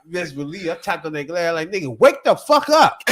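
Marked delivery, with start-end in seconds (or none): 1.7-3.0 loud, slow wake-up command; slow loud wake the fuck up. Retold or imitated. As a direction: bang on the glass and shout. Tapping glass to wake driver.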